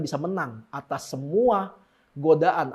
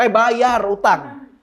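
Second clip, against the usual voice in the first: slow, louder scolding snap; sharp retort; 4 semitones higher, 6 dB louder, much slower than usual. Real, not acted. Preacher scolding a debtor, slow and louder. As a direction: cut in and scold.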